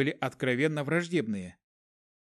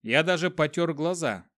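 plain reading voice

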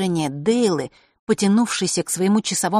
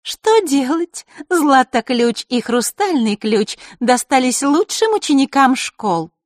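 put-on high, slow, smug girlish voice; higher gloating lilt; 8 semitones higher, 6 dB louder, slower than usual. A narrator's reading. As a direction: shrug it off with false modesty. Маришка gloats.

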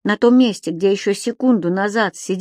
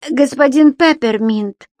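reproachful; 3 semitones higher, 4 dB louder, slower than usual. Acted. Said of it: "с некоторым упрёком в голосе".

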